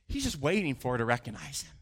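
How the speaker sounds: usual speaking voice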